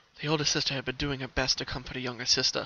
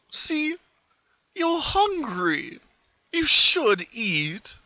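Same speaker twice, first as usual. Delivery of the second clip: very high, very slow sinister coaxing; smiling creepy colt; 12 semitones higher, 5 dB louder, much slower than usual. Acted. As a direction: purr it like a smiling torturer. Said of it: "the colt smiled".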